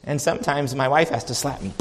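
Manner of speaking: ordinary delivery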